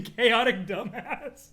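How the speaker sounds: laughing. Real, not acted. Laughing through a joke.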